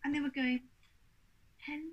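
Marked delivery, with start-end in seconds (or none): 1.6-1.9 mock patronising hello, drawn out softly. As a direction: greet them like a small child, softly. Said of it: "talk to him like a two-year-old".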